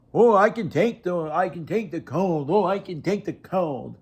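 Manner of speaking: a sarcastic parrot of people boasting about the cold; mocking tough guy. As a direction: mimic the bragging cold-tough crowd with scorn. Mocking people who claim toughness.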